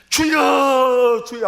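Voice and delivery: long drawn-out cry of "주여"; crying out to the Lord. Shown as a demonstration. Preacher showing the congregation how to cry out. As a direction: wail it high and slow. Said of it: "하고 살아가야 돼요".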